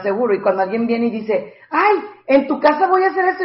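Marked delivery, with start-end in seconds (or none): 1.7-3.4 higher, breezy mock guest voice; pushy guest. A guest announcing plans for her house, mock breezy. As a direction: toss it off like a guest who assumes.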